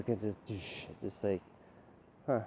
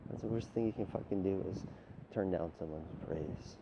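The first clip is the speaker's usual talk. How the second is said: normal talking voice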